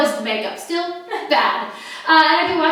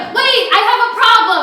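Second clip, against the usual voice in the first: high, loud mock-urgent plea; 10 semitones higher, 8 dB louder than usual. Comic imitating the guy's high, loud urgent plea. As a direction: call out like a drama emergency. Guy announcing his problem.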